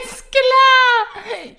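squeaked high through laughter; laughing. Real, not acted. Caught out, laughing back. (lachend, sehr hoch gequiekt)

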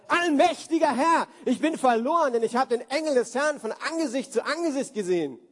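high, loud panicked outcry; crying out in terror. Acted. Gideon crying out after seeing the angel, high and loud in terror. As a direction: shout it up to heaven in fear. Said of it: "schrie er auf".

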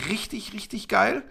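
whispered praise; quiet whispered enthusiasm. Real, not acted. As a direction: breathe it out in a whisper. Hushed praise of player.

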